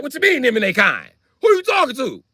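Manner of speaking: high, indignant challenge. Retold or imitated. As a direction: demand an answer, voice jumping up. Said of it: "So I get mad".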